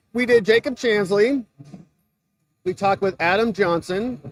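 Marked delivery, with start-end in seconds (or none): none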